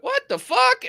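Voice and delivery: high-pitched, startled retold yelp; startled outcry. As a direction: cry out as if a fist just missed you. Retold startled reaction to punch.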